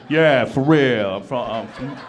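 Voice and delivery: loud drawn-out mock American reply; acted non-British-sounding Brit. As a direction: insist loudly, slowly, you're British. Acting the man who doesn't sound British.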